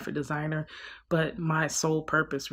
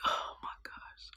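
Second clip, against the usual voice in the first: weary whisper; 13 dB quieter than usual. Retold or imitated. Groaning at nephew, depressed.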